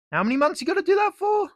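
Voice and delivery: a squeaky, hurried mock question; mock naive asker. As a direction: play the clueless person asking. Mock questioner voiced mid-rant.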